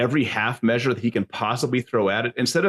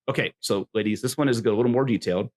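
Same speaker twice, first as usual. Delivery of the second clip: normal talking voice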